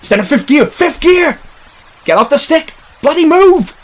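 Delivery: high yells, climbing to a shriek; panicked yelling. Acted. Passenger yelling at the driver in the chase, panicked. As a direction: yell in mounting panic.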